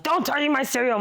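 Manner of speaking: high-pitched retold indignant protest; pissed off. Eight-year-old sister to dad over cereal, high mock outrage. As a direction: act out an outraged kid's warning. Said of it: "I was, like, pissed off".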